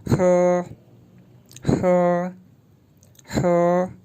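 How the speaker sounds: ह said breathy with a short vowel; ह like the Mandarin syllable he; pinyin he. Shown as a demonstration. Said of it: "इस तरह से pronounce होती है".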